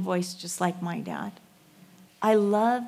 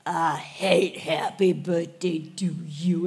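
put-on slow, lower, mumbled toddler voice; toddler whisper; 3 semitones lower than usual. Son at his birthday, a sulky toddler mumble. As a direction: mumble like a sulky three-year-old. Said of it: "I leaned in again".

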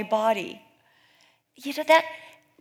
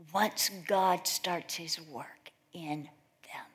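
slow, breathy whisper of awe; hushed; 4 semitones lower, 5 dB quieter, slower than usual. Real, not acted. Preacher marvelling at sinners' potential, slow hushed whisper. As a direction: let the words fall to a reverent whisper. Hushed wonder at God's work.

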